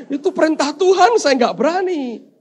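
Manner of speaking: high-pitched defensive protest; meek protest. Acted. Angel denying he changed the order, high meek protest. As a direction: protest innocence to an elder.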